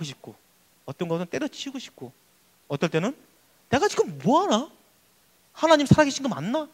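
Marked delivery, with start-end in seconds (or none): 3.7-6.7 high, wavering-pitch self-questioning; doubting. Acted. Congregant doubting whether God is alive, questioning. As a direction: wonder aloud in a raised voice. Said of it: "그럴 때도 있을 거예요".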